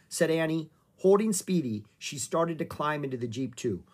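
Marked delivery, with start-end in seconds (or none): none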